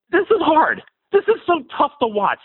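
high-pitched, exasperated fan outburst; fired-up, upset. Real, not acted. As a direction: let real frustration burst out high. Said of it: "I'm just so fired up".

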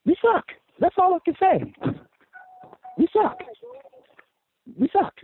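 high, strained yell of disgust; frustrated shout. Real, not acted. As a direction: cry it out like a fed-up fan at the TV. Caller ranting about his team.

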